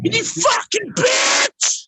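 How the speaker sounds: slow raspy crying yell; raspy shout. Real, not acted. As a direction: scream it hoarse and slow. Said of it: "Listen how raspy his voice is".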